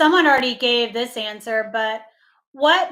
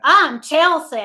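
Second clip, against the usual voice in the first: mock Southern accent; 5 semitones higher, 8 dB louder than usual. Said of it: "a little Southern accent".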